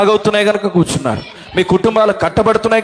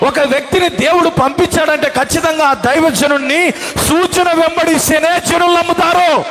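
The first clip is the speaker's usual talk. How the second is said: high, ringing sermon climax shouted to the hall; full-force preaching; 8 semitones higher than usual. Acted. Preacher proclaiming signs to the congregation, full-force preaching. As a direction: preach it out with fire.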